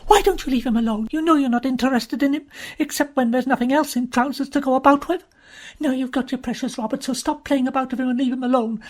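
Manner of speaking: loud, high, fast furious outburst; bursting out angrily. Acted. Jean raging at Uziette over Donald, loud and fast. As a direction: let it all burst out at her. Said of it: "She burst out".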